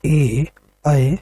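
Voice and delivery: ए and ऐ sounded out separately; vowel demonstration. Shown as a demonstration. Teacher showing students where ए and ऐ are formed, vowel demonstration. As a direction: say ए, pause, then ऐ as isolated vowels. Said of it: "तो हम बोलते हैं".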